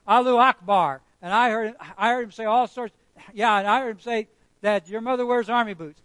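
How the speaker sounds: preacher mimicking the lying witnesses in court, loud; mock false witnesses. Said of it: "trotted out and saying".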